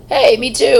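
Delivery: bright, loud eager reply. Retold or imitated. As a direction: jump in excited.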